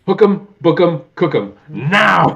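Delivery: hammed-up Gomez yelling at the cop; frustrated theatrical command. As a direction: bellow the order with mock fury. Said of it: "and he's like".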